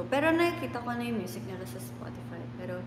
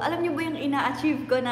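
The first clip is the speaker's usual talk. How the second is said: usual speaking voice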